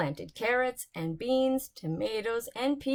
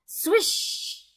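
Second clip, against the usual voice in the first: louder, higher breathy swish rising; windy swish; 10 semitones higher, 3 dB louder than usual. A narrator's reading. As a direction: whoosh it like a strong gust. Wind blowing in story.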